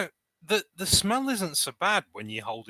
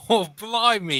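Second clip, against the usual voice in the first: higher, mock London "blimey"; mock Cockney exclamation; 9 semitones higher, 6 dB louder, slower than usual. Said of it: "his accent changed".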